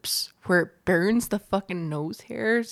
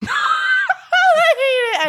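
high, drawn-out laughing wail; laughing squeal; 6 dB louder, much slower than usual. Real, not acted. Host cracking up while trying ASMR, laughing squeal. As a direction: squeal it through helpless laughter.

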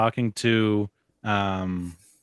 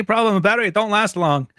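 put-on higher, louder kid complaint; Data voice; 9 semitones higher, 4 dB louder, faster than usual. Fan quotes Data griping about his flashlight batteries in a higher kid voice. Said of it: "And he's like".

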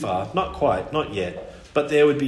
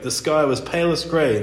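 ordinary delivery

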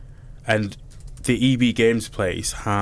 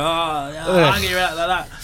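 higher-pitched imitation of an appalled friend; mock-appalled protest; mock disgust; 6 semitones higher than usual. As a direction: act out a friend's dismay, pitched up. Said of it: "Jack is looking away like".

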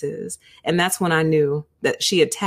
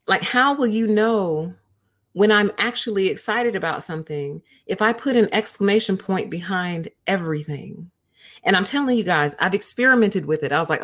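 usual speaking voice

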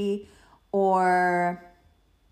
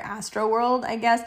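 ordinary talk, nothing marked

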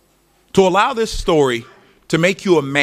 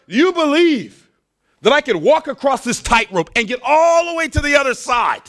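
high shouted showman's challenge; showman calling out; 9 semitones higher than usual. Acted. Tightrope walker challenging the crowd below: high shout. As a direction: call it out to a crowd below, slowed at the start.

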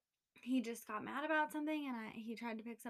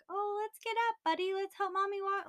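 put-on high, sing-song voice for a toddler; sweet toddler voice; 10 semitones higher, 6 dB louder than usual. A stranger coaxing the toddler off the floor, high and sweet. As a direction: coax the little boy up sweetly, much higher. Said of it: "just be like".

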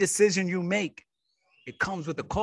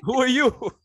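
high, loud teasing demand; mock challenge; 9 semitones higher, 6 dB louder than usual. Co-host mocking someone who claims authority, mock challenge. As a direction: hit it as a playful put-down.

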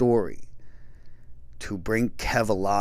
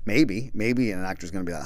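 normal talking voice

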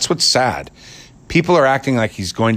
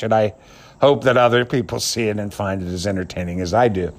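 ordinary talk, nothing marked

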